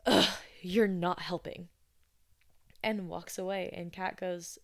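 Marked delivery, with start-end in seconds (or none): 0.1-1.7 annoyed. Retold or imitated. Annoyed guest snaps at Kat.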